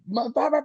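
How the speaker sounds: high-pitched wordless babble; babbled mock rant. Driver mimicking his own outburst, high babbled rant. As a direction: rattle off nonsense syllables for a tirade.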